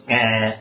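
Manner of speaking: short imitated goat bleat. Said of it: "the tiger goes".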